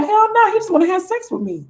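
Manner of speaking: high-pitched mock refusal; hammed-up suspicious lover; indignant woman. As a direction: play her scoffing, voice way up. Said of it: "And you're like".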